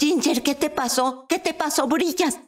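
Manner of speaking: high, alarmed questioning; astonished. Retold or imitated. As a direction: imitate a stunned friend. Stunned friend asking what happened.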